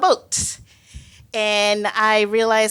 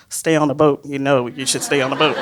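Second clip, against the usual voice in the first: lightly imitated mom, pitched low; a hint of a cautioning mother, lower voice; mom's voice; 7 semitones lower, faster than usual. Mom's voice in the speaker's head, lightly imitated warning. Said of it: "my mom's voice is like".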